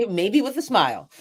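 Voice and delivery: amused afterthought. Real, not acted. Poet adding a joking afterthought to her advice, amused. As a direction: say it through a small laugh. Light afterthought, then repeated softly.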